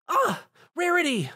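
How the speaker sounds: very high startled cry; alarmed cry. Acted. Spike cries out for Rarity, very high. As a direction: call her name in alarm.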